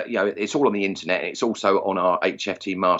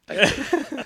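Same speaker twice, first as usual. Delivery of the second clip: much higher, mock-grateful thank you; sarcastic high thanks. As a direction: squeak it out sarcastically.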